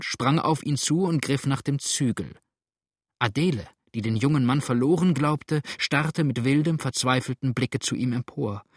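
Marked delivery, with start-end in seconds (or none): none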